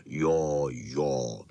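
low, rumbling agreement; Bernardín, deep dog. Acted. Bernardín agrees with the pack in a low voice. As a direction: drop it right down low. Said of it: "říkal Bernardin".

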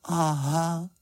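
small high-voiced dawning realisation; Paddington crestfallen. A narrator's reading. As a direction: say it sheepishly in a high little voice. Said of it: "řekl Paddington schlíple". (schlíple, vysokým hláskem)

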